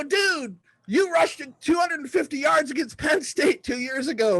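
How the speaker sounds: high excited blurt of a stat; starstruck kid. Retold or imitated. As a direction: gush at your hero. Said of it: "And I go".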